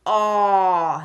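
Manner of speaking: long, low "aw"; short O sound. Shown as a demonstration. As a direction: hold "aw" as an isolated vowel. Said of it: "I'll say the sound".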